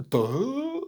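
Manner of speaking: high, soft, stupefied "duh"; dumbfounded. Retold or imitated. Group staring at the figure, dumbfounded. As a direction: sound struck dumb.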